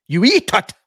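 acting out the film line for the co-host; excited movie quote. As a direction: shout it out with glee. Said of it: "quoted film dialogue".

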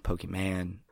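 "Pokemon" said POH-kee-man; "Pokemon" ending in "man", rhyming with "can"; variant pronunciation. Shown as a demonstration. Showing how people pronounce "Pokemon" differently, ending as "man". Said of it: "Isn't it weird how everyone pronounces".

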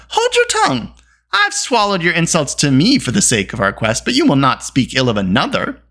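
heated rebuke; angry retort. A narrator's reading. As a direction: snap back in indignation, pitch jumping up first. Acted quarrel between rival companions.

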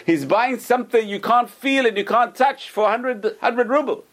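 high-pitched, incredulous scoffing; imitated jeering merchant; laughing mockery. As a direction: ridicule a fool to your friends. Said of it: "He starts laughing at the rabbi".

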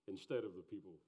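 ordinary delivery

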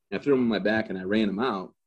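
ordinary talk

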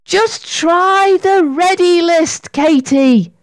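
high, drawn-out plea; urgent coaxing. A narrator's reading. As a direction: push a friend to try. Sarah urges struggling Katy on.

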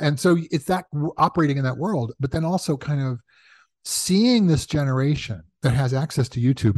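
normal talking voice

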